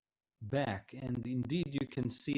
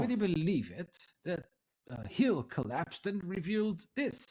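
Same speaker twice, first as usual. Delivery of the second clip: higher, swooping, marvelling delivery; old tiefling scholar, fascinated; 7 semitones higher than usual. Acted. Tiefling shows visitors the revealed ruin, lightly voiced with lifted wonder. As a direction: share a wondrous discovery.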